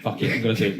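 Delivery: ordinary delivery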